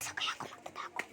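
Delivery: breathy hiding whisper; whispering while hiding. Real, not acted. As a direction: whisper so nobody finds you. Looking for a hiding spot.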